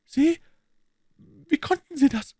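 high, startled questions; astonished Italian woman. A narrator's reading. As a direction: burst out in disbelief. (erstaunte Italienerin, hohe Stimme)

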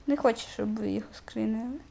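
quick secretive whisper; hushed quick aside to viewers. Real, not acted. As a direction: whisper it fast so nobody hears.

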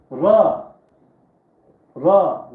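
Ra called out loudly to the class; loud drill call. Shown as a demonstration. Teacher drilling letters aloud for the class. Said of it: "letter drill, loud".

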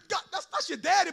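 ordinary delivery

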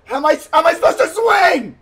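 high, fast, frantic shout; frantic, high-pitched yelling; panicked shout. Real, not acted. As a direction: yell it in real bewildered panic. Panicking at game obstacle.

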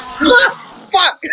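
shouted curse. Real, not acted. Startled by opened menu.

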